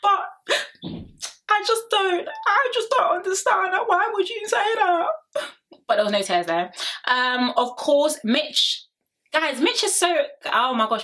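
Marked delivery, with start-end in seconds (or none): 0.0-5.0 very high, slow, whiny mock sob voice; hammed-up teary whimper; mock crying whine. Mocking Ella's fake tears to Mitch, high whiny voice. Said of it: "It was like".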